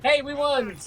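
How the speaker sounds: high, loud happy shout; excited cheer. Real, not acted. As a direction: celebrate the win out loud. Match victory.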